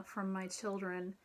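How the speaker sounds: ordinary talk, nothing marked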